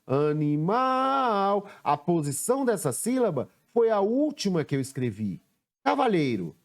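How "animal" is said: "Animal" drawn out slowly with a held MAL; chamando a palavra. Shown as a demonstration. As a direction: lift and sustain the last syllable of "Animal". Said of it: "Sílaba que eu pronunciei com mais força". ("Animal" com o MAL bem alongado)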